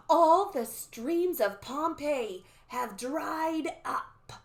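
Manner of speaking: slow, drawn-out eerie prophecy; dramatic, ominous crone voice; old lady soothsayer. Acted. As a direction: intone it like a creepy old seer. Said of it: "that's not the old lady talking".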